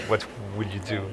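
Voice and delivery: ordinary talk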